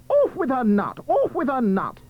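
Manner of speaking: high, forceful royal command; cried order. Acted. Prince condemning Cinderella to beheading, crying out. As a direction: cry the order out high, then repeat it. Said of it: "The prince cried".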